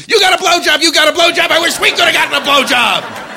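high-pitched imitation of cheering delegates; mock crowd scream. U N delegates cheering the president, mock screaming. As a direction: shriek it like an adoring crowd. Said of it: "They were screaming".

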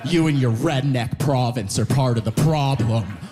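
low, slow mock lecture; scolding friends. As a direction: imitate friends laying blame, slow and emphatic. Friends scolding him in a retold row.

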